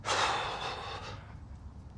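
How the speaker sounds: slow breathy exhale on a sigh; long audible sigh. Acted. Coach releasing tension along with listeners, audible sigh. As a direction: let the breath fall out.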